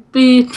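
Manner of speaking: imitated beep. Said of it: "Stand by".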